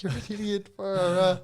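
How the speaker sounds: high-pitched mock exasperation, retold; blowing up. Scolding himself after getting locked out on the balcony, blowing up. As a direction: berate yourself in a high, exasperated voice. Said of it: "I was blowing up".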